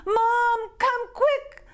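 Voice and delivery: high, loud frantic cry; panicked shout. Acted. Girl shouting for mom in a panic. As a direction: scream for help.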